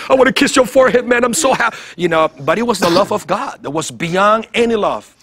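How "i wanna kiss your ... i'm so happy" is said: overjoyed. Retold or imitated. Overflowing joy, wanting to hug people.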